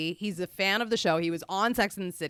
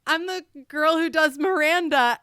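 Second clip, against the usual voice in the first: normal talking voice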